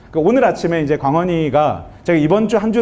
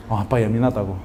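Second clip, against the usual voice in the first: very low, softer voice; subdued child's reply; 10 semitones lower, 5 dB quieter than usual. Retold or imitated. Son telling dad he is touchy, subdued. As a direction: answer quietly as a hurt child.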